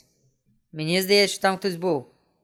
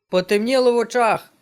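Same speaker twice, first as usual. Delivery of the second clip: raised, louder alarm; alarmed exclamation; 5 semitones higher, 4 dB louder than usual. Real, not acted. Player tells viewers his eyes went dark, alarmed. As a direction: cry out as it all goes dark. Screen suddenly goes dark.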